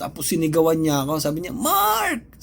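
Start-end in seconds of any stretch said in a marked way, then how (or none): 1.5-2.4 sharp high yell of a name; retold shout. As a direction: call the name out angrily across the room. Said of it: "yung sigaw, talagang sigaw". (pasigaw na pagtawag ng pangalan)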